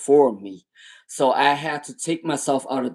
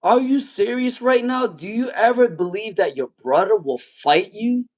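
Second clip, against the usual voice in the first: disbelief; 7 semitones higher than usual. Retold or imitated. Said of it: "And I was like".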